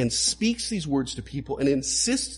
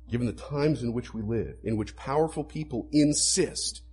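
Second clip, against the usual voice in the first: ordinary talk, nothing marked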